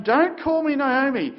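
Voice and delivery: high, loud lament, slowing on the second half; a preacher voicing a grieving widow's outcry; anguished plea. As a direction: cry out her new name in grief. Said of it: "here is Naomi weeping".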